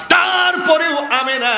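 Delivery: high, slow, ringing appeal; urgent appeal. Retold or imitated. Priest pleads with Amina despite her age, calling high. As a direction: call out high, stretching the words.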